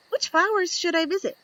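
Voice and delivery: high squeaky bee voice; a tiny curious bee peeking out; little bee voice. Acted. Young bee asks the reader, high and curious.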